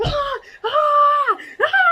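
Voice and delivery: loud, high screams; re-enacted screaming. Replaying her meltdown before boss, loud screams. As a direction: let out repeated hammed-up shrieks. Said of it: "I just start screaming".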